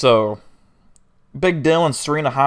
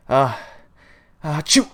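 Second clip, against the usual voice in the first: spoken "ah-choo", rising higher on the burst; mock sneeze; 5 semitones higher, 4 dB louder, slower than usual. Driver sneezing at the wheel, mock sneeze. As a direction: build up to a loud fake sneeze. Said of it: "I gotta sneeze".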